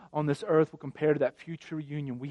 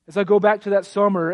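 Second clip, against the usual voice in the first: ordinary talk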